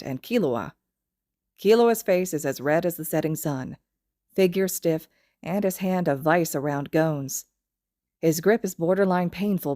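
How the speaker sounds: plain reading voice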